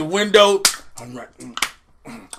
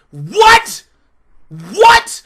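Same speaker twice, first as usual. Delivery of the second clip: high, loud, rising disbelief; incredulous what; 9 semitones higher, 6 dB louder than usual. Real, not acted. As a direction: react like you can't believe it. Outraged at the plot.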